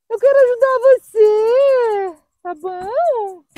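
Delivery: high, loud, drawn-out plea; tearful pleading. Real, not acted. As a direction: plead slowly with a scared dog, near tears. Pleading with the dog, near tears. (súplica chorosa, voz aguda e arrastada)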